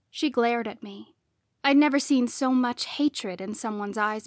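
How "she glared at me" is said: ordinary delivery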